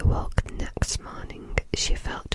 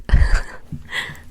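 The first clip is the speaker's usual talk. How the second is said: whispered laugh; 10 dB louder than usual. Real, not acted. Laughs at joke to kids.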